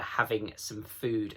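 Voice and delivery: ordinary delivery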